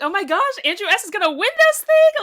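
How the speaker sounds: high, loud, giddy outburst; squealing that he's going to win; excited disbelief. Retold or imitated. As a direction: relive the moment you were sure he'd win. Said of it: "I remember I was like".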